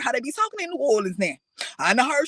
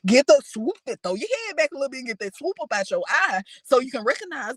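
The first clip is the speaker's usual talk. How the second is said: normal talking voice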